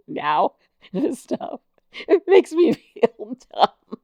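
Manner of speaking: high, slow self-mocking laugh through words; giggling, exasperated self-mockery. Real, not acted. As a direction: laugh at yourself. Laughs at himself.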